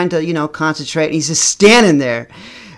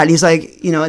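ordinary delivery

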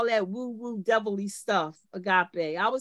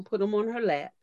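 ordinary talk, nothing marked

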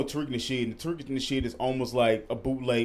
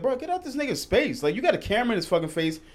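pitched-up outraged rant at the customer; indignant rant; 6 semitones higher than usual. Real, not acted. Friend ranting about a rude filmer, outraged and high. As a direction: call him out, fired up and high.

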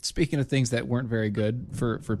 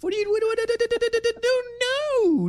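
squeaky high mock panic, much louder; mock panicked squeal; 3 dB louder than usual. Liberal squeals at the Trump plan, high mock panic. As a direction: squeal in fake alarm at the idea.

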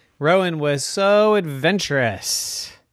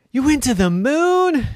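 high, wide-eyed question; a kid astonished; amazed little unicorn; 12 semitones higher, 5 dB louder than usual. Acted. Rowan amazed.

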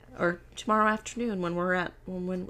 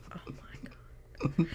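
whispered mock dismay; hushed disbelief; 4 dB quieter than usual. Storyteller reacts to her drunk-sounding friend, hushed disbelief. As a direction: say it under your breath, stunned. Stunned aside in retold story.

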